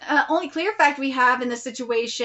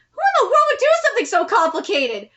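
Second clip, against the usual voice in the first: exasperated protest; 6 semitones higher, 4 dB louder than usual. Real, not acted. Reader protests the plot.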